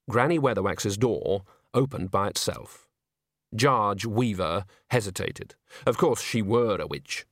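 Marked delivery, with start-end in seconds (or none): none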